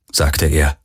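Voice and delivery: ordinary delivery, nothing marked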